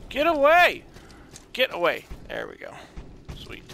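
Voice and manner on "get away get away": high, loud shout at attackers; shrill-free loud get away; panicked shout. Real, not acted. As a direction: yell them off you. Enemies swarming the character.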